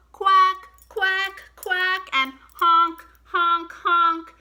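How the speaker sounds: loud high quacks and honks; shouted animal calls. Shown as a demonstration. Teacher shouting duck and goose noises for the kids, loud and high. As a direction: call out like noisy ducks and geese. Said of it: "Shout 'em out".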